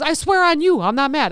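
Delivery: mock-earnest denial; retold protest. Retold quarrel with Steve.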